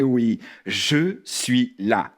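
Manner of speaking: low, slow and comforting; reassuring. Acted. Host telling scared dog he is there, reassuring. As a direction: say it slowly, deep and soothing.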